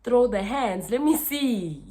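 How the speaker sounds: daring challenge. Retold or imitated. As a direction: call her bluff, high and loud. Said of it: "That's how I literally said".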